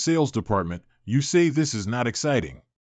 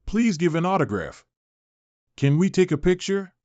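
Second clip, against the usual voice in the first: excited fan; 6 semitones higher than usual. Acted. Fans mobbing a star.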